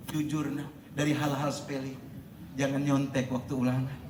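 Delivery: normal talking voice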